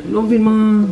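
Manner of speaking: ordinary delivery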